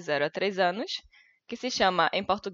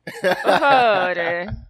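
put-on Spanish accent; 9 dB louder, much slower than usual. Mock Spanish plea, drawn out.

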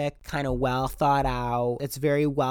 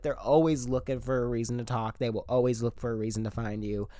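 ordinary talk

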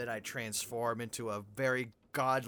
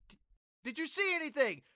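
higher, louder flustered mother; high mom voice; 13 semitones higher, 5 dB louder than usual. Acted. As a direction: fuss like a caught-out mom. Mother scolding son.

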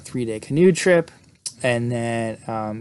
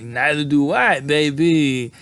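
sleazy guy; 2 semitones higher, slower than usual. Retold or imitated. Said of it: "he's like".

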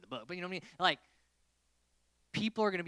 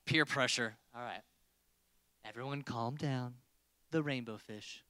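ordinary talk, nothing marked

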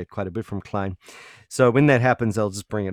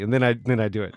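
ordinary delivery

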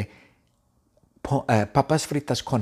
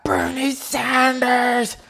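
high, slow drawn-out yell of the name; warden yelling; 11 semitones higher, much slower than usual. Acted. Warden calling the fleeing prisoner back, slow high yell. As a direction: stretch the name out as a long call. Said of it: "Entonces ella grita".

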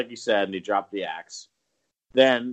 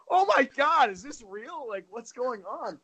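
high panicked; 13 semitones higher than usual. Retold or imitated. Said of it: "I was like".

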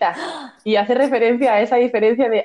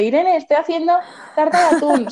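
high, bright-eyed excitement over the tuna cake; imitating a proud cook showing off; a cheerful friend announcing her tuna cake; super happy; 8 semitones higher than usual. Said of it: "súper contenta".

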